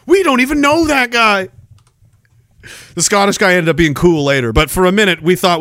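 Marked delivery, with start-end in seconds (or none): 0.1-1.5 innocent denial. Retold or imitated. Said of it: "We're like".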